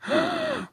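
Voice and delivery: big high theatrical gasp; mock gasp. Parent hamming up surprise over the fireman for a toddler, big gasp. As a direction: gasp as if shocked, for a small child. Parent acting shock for child.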